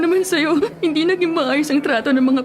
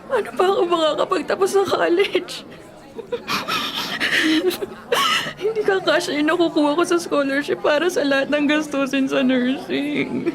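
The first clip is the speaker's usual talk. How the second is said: tearful despair; 5 semitones higher than usual. Acted. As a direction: voice breaking near tears. Orphaned Lena despairs to boyfriend.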